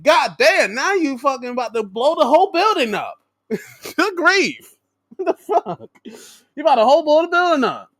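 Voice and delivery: amazed ranting. Real, not acted. Ranting in disbelief.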